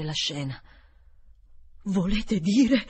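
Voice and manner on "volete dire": balbettando. A narrator's reading. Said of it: "balbettò".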